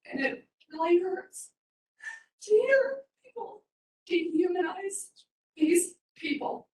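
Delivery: high, strained voice close to real tears; emotional. Real, not acted. Resident pleading for homeless people at meeting, emotional. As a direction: choke up, halting between phrases. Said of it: "I'm a little emotional there".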